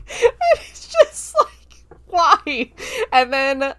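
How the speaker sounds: high loud laughing cry of why; real laughing outburst; laughing disbelief. Real, not acted. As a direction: shriek through laughter.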